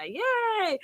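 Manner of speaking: high, bright little cheer; excited cheer. Real, not acted. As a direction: cheer happily for the greeting. Cheering a listener hello.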